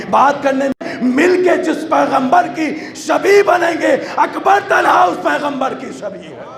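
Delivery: high, forceful sermon delivery; impassioned preaching. Real, not acted. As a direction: preach it with rising force to the congregation.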